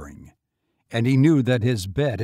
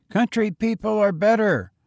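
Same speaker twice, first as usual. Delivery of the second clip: put-on higher, eager boy voice; young boy; 10 semitones higher, 5 dB louder than usual. A narrator's reading. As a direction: pitch up for a street boy. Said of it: "the boy interrupted".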